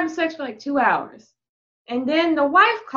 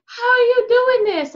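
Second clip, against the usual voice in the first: amazed client; 10 semitones higher, 5 dB louder than usual. Retold or imitated. Client in story asks, amazed.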